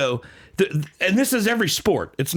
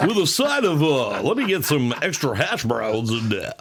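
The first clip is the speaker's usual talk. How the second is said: announcer ordering food. Acted. Announcer giving a waitress the order, hammed-up announcer voice. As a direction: sell each menu item like a sports call. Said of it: "imagine he's just ordering".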